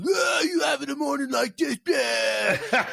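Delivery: high-pitched mimicking yell; mock strained shout. Mimicking the co-host's morning post, mock high shout. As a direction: yell it high and strangled, hammed-up.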